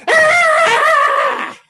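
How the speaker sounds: long, very high, loud scream; terrified scream. Acted. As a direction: shriek like a frightened boy. Scared boy screams at the bear.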